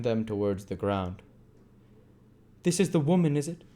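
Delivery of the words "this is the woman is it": higher, louder demand. A narrator's reading. Monks demanding of Bumble, raised voice. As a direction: press him for an answer. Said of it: "demanded Monks".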